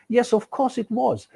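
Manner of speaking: usual speaking voice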